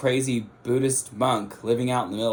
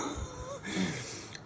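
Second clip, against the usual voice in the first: soft breathy laugh; 12 dB quieter than usual. Real, not acted. Nervous laugh after whisper.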